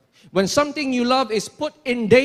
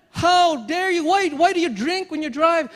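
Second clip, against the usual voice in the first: higher-pitched outraged scolding; acted anger; 5 semitones higher, 3 dB louder than usual. Parent confronting the drunk driver who endangered their child, acted anger. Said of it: "You will get so angry".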